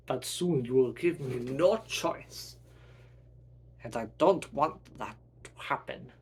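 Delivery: put-on menacing German accent; German-accented foe, swelling on threats; German villain. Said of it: "you demented German man".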